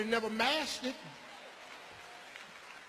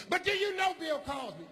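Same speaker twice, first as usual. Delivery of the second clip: higher incredulous teacher; 9 semitones higher than usual. Retold or imitated. Teacher cross-examining the boy, high doubting voice.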